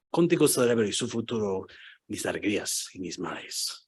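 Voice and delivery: low, soft poem recital; deep-voiced Spanish. Reciting a favorite poem for the professor, deep voice. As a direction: recite lyrics as solemn poetry, way down low. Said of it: "I spoke Spanish with this really deep voice".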